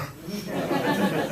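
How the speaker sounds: short chuckle at own joke; amused chuckle; laugh. Real, not acted. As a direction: laugh at your own bad gag.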